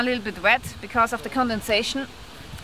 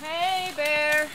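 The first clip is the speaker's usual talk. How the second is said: higher, drawn-out call to a bear; scaring off a bear; 5 semitones higher than usual. Real, not acted. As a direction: call it out long across the forest. Calling out to warn bear.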